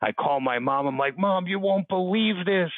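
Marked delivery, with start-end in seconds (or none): 1.2-2.7 thrilled son on the phone; breathless excitement. Retold or imitated. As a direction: gush the news to mom. Excited phone call to mom.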